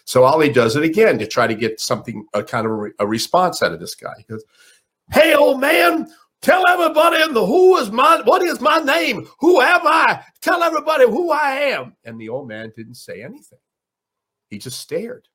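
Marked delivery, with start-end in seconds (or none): 5.1-12.0 high, loud boxer voice calling out to the room; Ali impression. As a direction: shout it high like the champ, full of himself. Ali challenges the old man.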